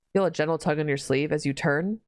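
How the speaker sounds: ordinary delivery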